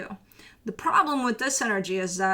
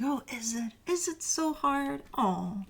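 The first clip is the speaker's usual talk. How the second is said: slow mock-sympathetic tease, higher on "so hard"; sarcastic; 4 semitones higher, 5 dB quieter than usual. The universe mocking a client who calls the task hard, sarcastic. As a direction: pretend to pity them, dripping sarcasm. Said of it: "with this kinda sarcastic".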